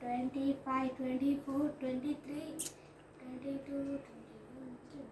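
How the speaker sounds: soft steady countdown; quiet countdown. Real, not acted. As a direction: count down softly under breath. Counting down the timer.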